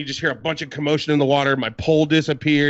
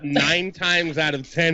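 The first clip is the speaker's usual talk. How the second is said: normal talking voice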